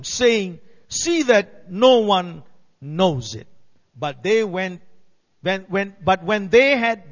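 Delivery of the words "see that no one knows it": slow, firm, louder warning; stern warning. A narrator's reading. Jesus warning the healed blind men, sternly. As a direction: lay it down slowly and sternly. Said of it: "Jesus sternly warned them".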